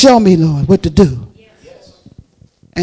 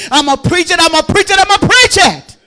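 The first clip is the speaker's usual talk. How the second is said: building defiance; 8 semitones higher, 9 dB louder than usual. Retold or imitated. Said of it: "devil said, when they come, I said".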